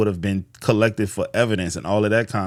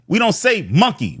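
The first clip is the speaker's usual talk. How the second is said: normal talking voice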